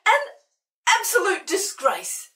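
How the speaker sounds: shrieked. Acted. Old Sid shrieks at Hamish over his garden. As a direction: shriek it at the cat in outrage. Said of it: "he shrieked".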